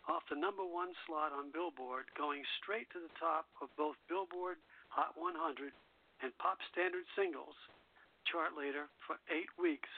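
ordinary delivery

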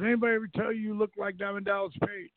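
lightly voiced excited fan; excited soldier.

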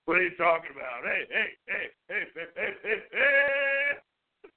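badly faked sportscaster with rising heys; John Madden. Second try after "keep going", badly faked cartoonish impression. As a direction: botch the impression into a cartoon dog. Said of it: "it sounded like a McGruff the Crime Dog".

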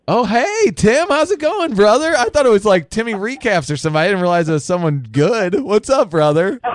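high, loud, thrilled welcome; excited greeting. Real, not acted. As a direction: greet him like a big name. Hyped greeting of a caller.

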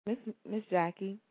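ordinary talk, nothing marked

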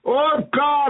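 usual speaking voice

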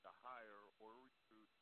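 buzzy glitchy call audio; breaking up on a bad line; cutting out. Real, not acted. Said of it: "[name], you're cutting out".

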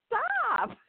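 squeaky high mock plea to stop; a high, half-laughing stop; mock cry of stop. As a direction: yelp it way up high, overwhelmed. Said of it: "I'm like".

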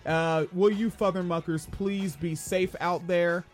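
ordinary talk, nothing marked

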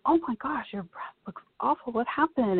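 imitating a shocked doctor; freaked out. Surgeon reacting to a badly bruised breast, alarmed. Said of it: "She was, like, freaked out".

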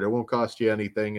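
ordinary talk

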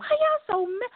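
high, whiny, half-crying disbelief; mock bewildered. Asking angry online posters why they are so mad, high and whiny.

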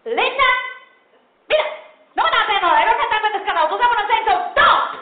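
high, loud Spanglish rant; mother screaming. Retold or imitated. Puerto Rican mother screaming at her daughter, loud. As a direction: yell at your daughter in Spanglish. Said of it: "start screaming".

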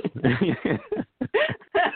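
short breathy laugh. Real, not acted. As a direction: laugh it off lightly. Candidate pauses, laughs at himself.

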